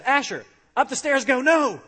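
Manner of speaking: loud, high mock shout at a toddler; shouted parental command. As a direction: call it out, punching first and last words. Father acting out commands to toddler.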